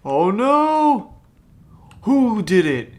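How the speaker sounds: loud, high, swooping outcry; alarmed wail. Real, not acted. Alarmed reaction during horror game.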